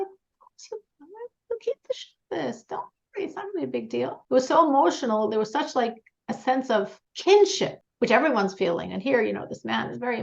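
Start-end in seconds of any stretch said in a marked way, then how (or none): 0.0-4.2 soft reassurance. Retold or imitated. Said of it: "he was like".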